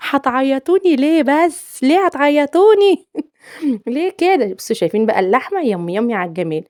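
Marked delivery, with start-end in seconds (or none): 0.1-4.5 high, loud, slow mock wail; hammed-up whimper, pleading with viewers; playful fake sob, high and drawn out; mock crying plea. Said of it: "هعيط والله العظيم".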